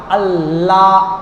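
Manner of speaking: আল্লাহ with the double L held on the palate, low and drawn out; held L sound. Shown as a demonstration. Preacher shows where the tongue touches, held L in আল্লাহ. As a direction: rest the tongue on the L of আল্লাহ, slowly. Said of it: "মাটির সাথে জিহ্বাটা একটু লাগে".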